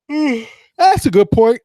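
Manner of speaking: words stretched through a yawn. Real, not acted. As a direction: say it mid-yawn, slowly. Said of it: "Excuse me for the yawn".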